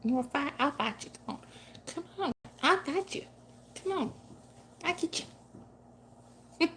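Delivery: high, cooing mock-tough voice to a cat; playful pet talk. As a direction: tease the cat like a tiny boxer. Playfully taunting her wrestling cat.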